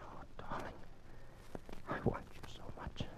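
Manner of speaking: breathy intimate whisper. Acted. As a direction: whisper it close into her ear. Lovers' intimate embrace.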